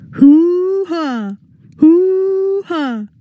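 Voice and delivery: high, hooting whoop; silly whooping. Acted. Silly brothers whoop over money.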